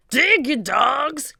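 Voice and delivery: slow loud pirate roar; cried order. A narrator's reading. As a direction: drag out the insult at the crew. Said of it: "cried Captain Bones".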